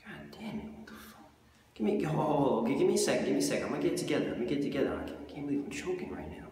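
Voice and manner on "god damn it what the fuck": whispered curse. Acted.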